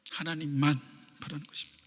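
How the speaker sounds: a breathy whisper, barely audible. Shown as a demonstration. Preacher echoing the point to the congregation, whispered. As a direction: whisper it as the closing echo. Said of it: "repeated as a whisper".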